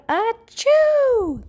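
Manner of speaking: huge high acted sneeze; big loud sneeze. A narrator's reading. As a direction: blast out a giant sneeze. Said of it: "sneezed a big, loud".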